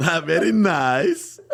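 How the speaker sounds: high, slow, drawn-out catchphrase; Borat. Acted. Comic proving the impression always works, Borat voice. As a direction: stretch it out in a hammed-up foreign accent. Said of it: "I did Borat impression".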